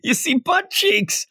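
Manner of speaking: high-pitched giddy shock; amused disbelief. Real, not acted. Host to co-host on an unexpected manga panel, amused disbelief. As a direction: exclaim it high, scandalized and tickled.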